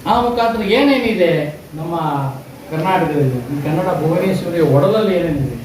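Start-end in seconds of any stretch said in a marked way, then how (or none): none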